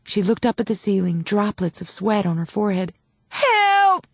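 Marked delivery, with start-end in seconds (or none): 3.3-4.1 high desperate cry for help; a labouring woman crying out for help; cried out. A narrator's reading. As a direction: yell it to the ceiling in agony. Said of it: "she cried".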